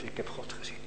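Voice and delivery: hushed whisper of awe; trembling whisper. Real, not acted. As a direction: confess it under your breath. Awe at seeing God.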